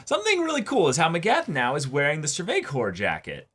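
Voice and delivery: ordinary delivery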